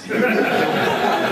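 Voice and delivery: a quick, high breath of laughter; short airy laugh. Real, not acted. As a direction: laugh at the irony. Laughing at his own irony.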